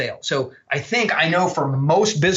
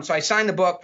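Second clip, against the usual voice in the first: ordinary talk, nothing marked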